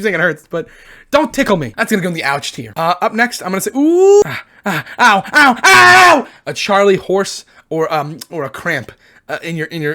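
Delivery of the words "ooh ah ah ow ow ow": building to a high, loud ow; wince, then cry out louder and louder; acted cries of pain. Vlogger acting out a cramp, cries building to a loud high ow.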